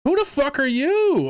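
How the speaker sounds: high, loud disbelieving yell; incredulous shout. Real, not acted. Streamer yelling at the statement's author, outraged. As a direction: shriek it at the screen in disbelief.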